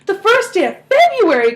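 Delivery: high, loud burst of surprise; excited cry. A narrator's reading. Mother cries out to her family, high and loud. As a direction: cry out the date in a rush of excitement. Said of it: "cried Missus Bucket".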